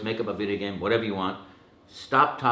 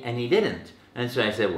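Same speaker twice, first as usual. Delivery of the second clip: normal talking voice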